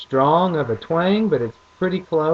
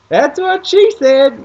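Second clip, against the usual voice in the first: high, loud, slow put-on voice for dad; dad's voice; 10 dB louder, slower than usual. Imitating dad cracking a joke at the casino, high and loud. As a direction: ham up the dad's punchline. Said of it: "my dad's like".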